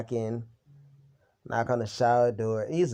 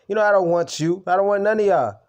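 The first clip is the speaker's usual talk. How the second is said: louder and higher, real anger rising; angry outburst; 7 semitones higher, 7 dB louder than usual. Real, not acted. Telling off the roommate, loud and furious. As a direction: let the anger boil over.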